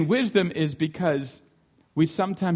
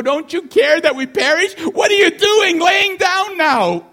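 high, loud, slow panicked shout; frantic disciples shouting; 13 semitones higher, 8 dB louder than usual. Retold or imitated. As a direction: cry out in the storm like terrified sailors. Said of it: "And they go".

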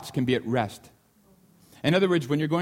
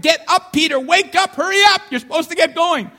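high, loud urgent wake-up call; urgent angel; 12 semitones higher, 7 dB louder than usual. Retold or imitated. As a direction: rouse a sleeping man in a hurry. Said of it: "the angel had to kick him".

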